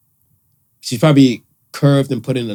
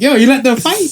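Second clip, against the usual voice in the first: incredulous; 8 semitones higher than usual. Retold or imitated. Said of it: "I was like".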